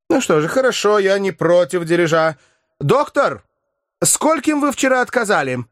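a lightly voiced professor, dry and mocking; ironic professor. A narrator's reading. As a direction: hand out the bill with cold irony.

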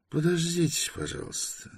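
high, soft polite request. A narrator's reading. Lieutenant putting off an anxious visitor, polite. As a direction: politely ask him to wait, voice lifted then dropping. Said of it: "вежливо ответил лейтенант".

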